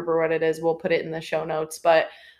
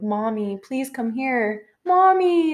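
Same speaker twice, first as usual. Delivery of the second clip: put-on a child's voice crying out from downstairs; imitated scream for mommy; 5 semitones higher, much slower than usual. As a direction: call for mommy in growing panic. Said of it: "my voice, like, screaming".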